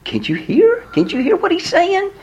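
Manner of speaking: high, strained exasperated appeal; frustrated pleading. Acted. Onlookers pleading with the doubters, high and frustrated. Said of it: "have you ever been frustrated like that".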